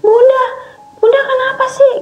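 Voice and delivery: put-on high, frightened child calling mom; scared child. Frightened child calls her mother. (suara anak kecil yang ketakutan)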